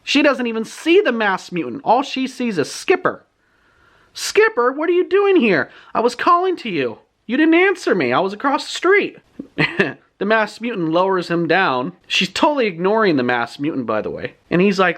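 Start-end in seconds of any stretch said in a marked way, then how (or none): 4.1-9.2 put-on high-pitched, put-out girl voice; exasperated, voice pitched way up; scolding girl. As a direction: imitate a girl fussing at her friend. Libby scolds Skipper on finding him.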